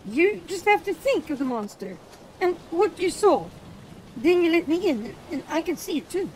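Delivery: put-on high, eager boyish voice; a boy pleading to be shown. A narrator's reading. Gareth asks Rune to let him into his mind, high eager boy voice. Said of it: "He spoke eagerly".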